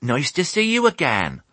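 high-pitched greeting from a sheep; chirpy sheep character; sheep crying out. A narrator's reading. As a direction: pipe up high to greet a friend. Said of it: "they cried".

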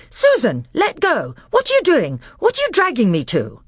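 put-on high, alarmed boy's voice. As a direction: cry out in alarm. Peter being pulled.

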